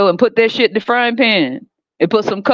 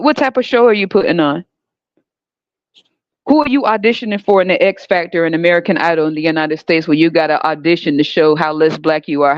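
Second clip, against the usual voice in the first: ordinary talk, nothing marked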